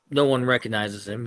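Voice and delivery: ordinary talk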